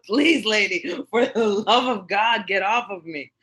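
loud, high, begging cry for help; mock desperate plea. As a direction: beg her loudly to let go. Retelling pleading with the woman.

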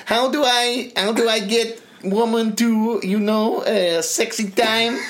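high mock Kazakh accent, slow at the end; Borat impression. Borat asking the dating agency, mock accent. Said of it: "And he was saying".

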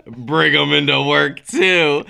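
slow, knowing Bring 'em into work too., every word set apart; air-quote irony. Retold or imitated. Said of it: "and I go with air quotes".

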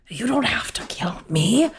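hushed, urgent whisper; whispered plea. Acted. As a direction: breathe the words to him alone. Pleading with former friend.

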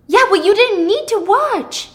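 loud, high-pitched comeback; angry retort. Acted. Thomas rejecting Pippa's excuse, loudly angry. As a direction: throw the excuse back at them.

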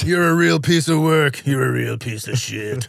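slow, slurred movie tough-guy drawl; Stallone impression. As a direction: growl it slowly like a boxing hero.

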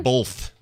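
Both said as "bolth"; Both pronounced "bolth", plainly shown; added L in Both. Shown as a demonstration. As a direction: slip an L into Both, before the th. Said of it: "Say that again".

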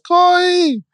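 put-on very high toddler voice calling out; squeaky, drawn-out child's copy of a call; mimicking little child. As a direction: echo the call as a small child would. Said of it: "And Sarai says".

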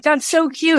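gushing. Retold or imitated. Friends gushing at dinner.

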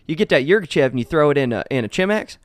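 hammed-up Southern accent. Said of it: "I'll just do it in my redneck voice".

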